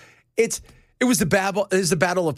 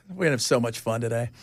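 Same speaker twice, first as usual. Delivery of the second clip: normal talking voice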